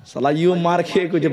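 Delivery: normal talking voice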